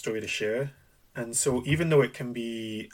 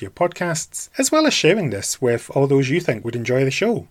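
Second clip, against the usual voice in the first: podcast outro; 9 dB louder than usual. Acted. Podcast outro call to action.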